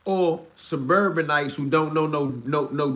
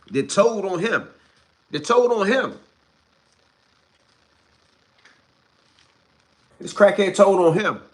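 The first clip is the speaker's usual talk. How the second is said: building louder and higher each time; outraged shout; 7 semitones higher, 8 dB louder than usual. Real, not acted. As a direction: hammer it in, getting angrier. Outraged rant about an informant.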